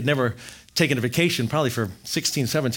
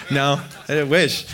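usual speaking voice

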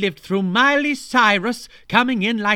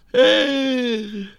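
choking gasp; 8 semitones higher, 6 dB louder than usual. Acted. Choking on the biscuit.

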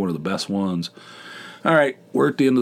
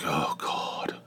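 weary whisper; 6 semitones lower, 10 dB quieter than usual. Retold or imitated. Groggy at work early Saturday.

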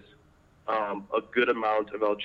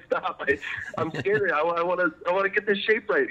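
mock scared; 8 semitones higher than usual. Retelling her nervous reply to mentor.

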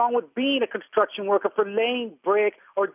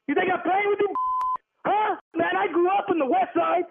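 heated; 6 semitones higher than usual. Real, not acted. Prank call victim challenges the caller, angry and high-pitched. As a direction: pitch jumps high, hard-edged challenge.

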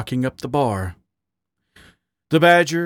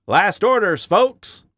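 higher-pitched closing-time announcement; barman's call; 8 semitones higher than usual. Acted. Doberman barman to the late-night pair, calling out. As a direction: call it out to the customers.

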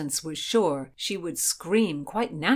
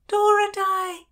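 high, loud, stretched-out scream; screamed call; 6 dB louder, slower than usual. A narrator's reading. Heroine calls her friend across the water, screaming. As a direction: scream the name to catch her attention. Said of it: "Constance screamed".

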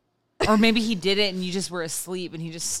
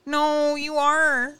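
high, slow mocking comfort; high reassuring mock; 6 semitones higher, slower than usual. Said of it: "being like".

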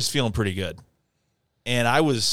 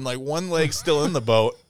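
ordinary delivery

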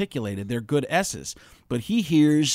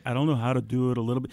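ordinary talk

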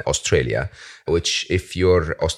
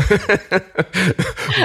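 high, bursting laugh at his own joke; laughing at the accent joke; warm laugh; 13 semitones higher than usual. Real, not acted.

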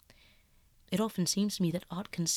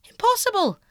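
much higher, loud cry; sharp refusal; 12 dB louder than usual. Acted. Dorian refusing to sit for Basil, loud high cry. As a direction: fling it out, refusing.

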